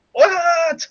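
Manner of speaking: high, incredulous yelp; stunned what. Retold or imitated. Listener hearing the recorder answer, stunned. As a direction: react as if you can't believe it.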